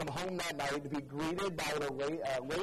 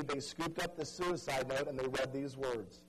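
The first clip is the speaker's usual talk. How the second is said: ordinary talk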